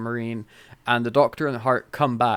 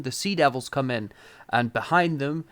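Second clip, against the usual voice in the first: normal talking voice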